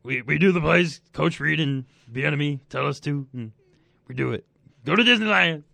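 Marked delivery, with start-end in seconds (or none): 4.8-5.6 Patrick Mahomes impression. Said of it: "that was my Patrick Mahomes impression".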